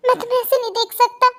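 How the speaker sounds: usual speaking voice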